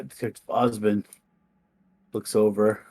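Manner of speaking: ordinary delivery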